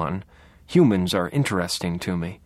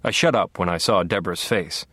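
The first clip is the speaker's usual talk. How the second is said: ordinary delivery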